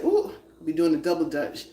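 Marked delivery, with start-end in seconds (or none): 0.0-0.3 high startled ooh; surprised ooh. Retold or imitated. Donna reacting mid-flip, high surprised ooh. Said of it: "I'll be like".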